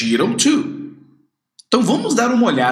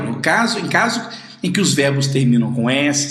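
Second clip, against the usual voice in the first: normal talking voice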